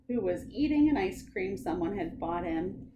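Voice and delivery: ordinary delivery, nothing marked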